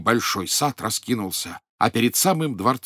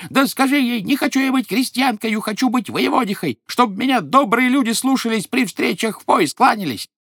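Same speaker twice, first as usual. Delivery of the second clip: much higher, louder shrieking tirade; furious old wife; 10 semitones higher, 4 dB louder than usual. Acted. Greedy old wife screams at husband demanding more, furious shriek. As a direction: rage at him, every line a screech. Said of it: "ещё пуще прежнего сердится".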